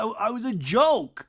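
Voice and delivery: high, slow whiny insistence; mock defensive. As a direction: drag out the excuse. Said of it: "Jesse Solomon was like".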